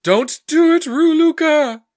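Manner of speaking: high, loud melodramatic cry; hammed-up anguished shout; crying to the heavens. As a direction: wail a plea up to the sky. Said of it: "he cries to the heavens".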